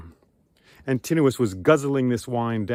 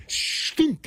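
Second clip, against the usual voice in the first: whooshing arrow sound. A narrator's reading.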